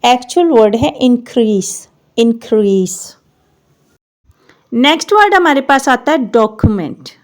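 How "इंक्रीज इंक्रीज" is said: इंक्रीज ending in "s", not "z"; correct pronunciation. Shown as a demonstration. Teacher modelling इंक्रीज without the z. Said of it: "ज़ेड की हम sound देते हैं ये नहीं देनी".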